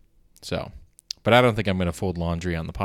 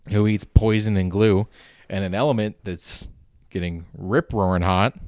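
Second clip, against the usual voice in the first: ordinary talk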